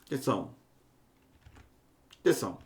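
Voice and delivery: atenção dropped low and soft, twice; quiet call for attention. Real, not acted. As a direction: pull them in with a hushed atenção. Professor chama atenção dos alunos. (atenção dita baixinho e grave)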